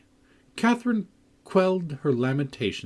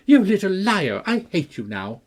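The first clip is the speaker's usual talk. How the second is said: loud high furious outburst; panting anger; 9 semitones higher, 6 dB louder than usual. A narrator's reading. Cathy furious at Linton, loud and panting. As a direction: spit it out breathless with rage. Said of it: "she panted".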